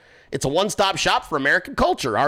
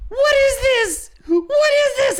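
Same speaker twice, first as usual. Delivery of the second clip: much higher, louder mock shriek, slow; imitating her scream; 4 dB louder, slower than usual. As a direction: squeal like the outraged girlfriend. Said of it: "You should have heard her scream".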